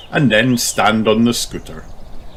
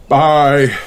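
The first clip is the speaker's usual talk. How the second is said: panting; 6 semitones higher, 6 dB louder than usual. Acted. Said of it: "still panting".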